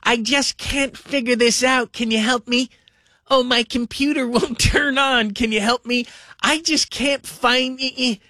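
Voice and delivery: high, slow helpless parent voice; mock a confused parent pleading for tech help; imitating parents. Son imitating his tech-helpless parents, high and slow. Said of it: "you sound like, you know, my parents".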